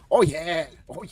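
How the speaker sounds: ordinary talk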